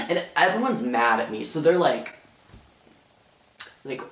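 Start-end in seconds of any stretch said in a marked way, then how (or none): none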